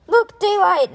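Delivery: very high, loud excited shout. Acted. A kid shouting at the sight of daylight. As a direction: point it out with a yell. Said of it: "Naughty shouted".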